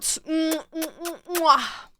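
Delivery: usual speaking voice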